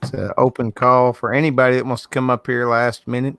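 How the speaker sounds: ordinary talk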